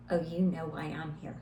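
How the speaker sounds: low, quiet and firm; mama bear staying calm but firm. Retold or imitated. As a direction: say it calmly with steel underneath. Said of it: "I just calmly, but firmly, was like".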